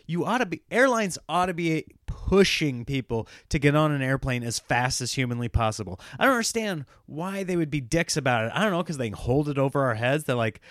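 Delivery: usual speaking voice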